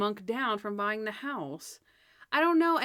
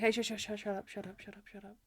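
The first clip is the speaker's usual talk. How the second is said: fading shush; 10 dB quieter than usual. Retold or imitated. Monk silencing Natalie's toast, fading to a hush. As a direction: shut up repeated, growing softer until barely heard. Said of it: "And he's like".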